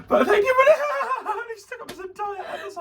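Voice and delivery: high voice breaking up with real laughter; laughing. Real, not acted. Thanking viewers who stuck with the episode, real laughter. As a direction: giggle helplessly through the words. Cracking up during the sign-off.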